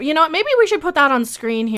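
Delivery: ordinary delivery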